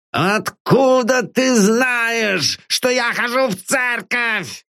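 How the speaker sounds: slow, high, shouted roar like a cornered beast. Acted. Cornered master roars at his nosy servant. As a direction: bellow it like a hunted animal. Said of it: "взревел Норман".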